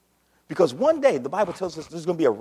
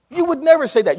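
louder emphatic retort; 9 semitones higher, 8 dB louder, faster than usual. Real, not acted. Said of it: "repeated louder".

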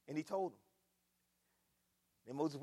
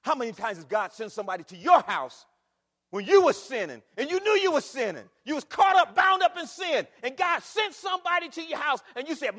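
shouted, much higher and louder climax; full-force preaching; 7 semitones higher, 15 dB louder than usual. Acted. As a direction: drive it home, building to a roar.

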